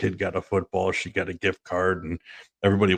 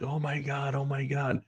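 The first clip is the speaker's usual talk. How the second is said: slightly higher, softer mock fretting; retold onlooker's alarm; 6 semitones higher, 7 dB quieter than usual. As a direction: lightly imitate a worried lady. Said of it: "This lady's like".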